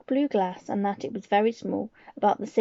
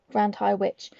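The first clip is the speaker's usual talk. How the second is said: ordinary delivery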